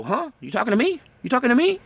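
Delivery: puzzled deer voice. Retold or imitated. Deer turning back to the hunter, baffled.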